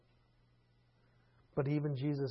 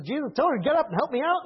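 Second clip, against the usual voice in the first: higher, louder, fast whiny appeal; exasperated complaint; 10 semitones higher, 8 dB louder, faster than usual. Retold or imitated. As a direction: tattle to Jesus in a rush. Martha complaining to Jesus.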